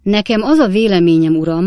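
put-on loud drunken declaration. A narrator's reading. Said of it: "mondta Stiggins úr nagyon hangosan". (nagyon hangos, részeg kijelentés)

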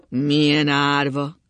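loud, slow, sustained moan.

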